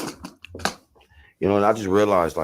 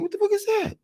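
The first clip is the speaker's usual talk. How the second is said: squeaky shocked disbelief, much higher. Retold or imitated. Retelling first sight of the drug, shocked high voice. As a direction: ask it in stunned high-pitched shock. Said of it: "I'm like".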